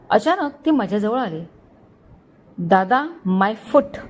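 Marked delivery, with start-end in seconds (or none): 2.6-4.0 slow, drawn-out scornful comeback; angry retort. A narrator's reading. Girl throwing "dada" back at a senior, slowly angry. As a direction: stretch out each word in mild anger. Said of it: "ती रागाने बोलली".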